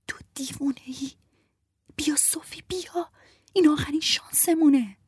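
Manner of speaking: high, hushed urgent pleading; exasperated whisper. Acted. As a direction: hiss at her to hurry before the guard wakes. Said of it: "زیر لب گفت".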